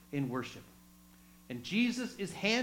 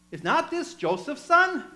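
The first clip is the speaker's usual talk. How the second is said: high, louder questioning voice; townsperson asks; 9 semitones higher, 6 dB louder than usual. Acted. Somebody in the synagogue questioning Jesus, surprised. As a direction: ask it like a surprised neighbour. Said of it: "somebody says".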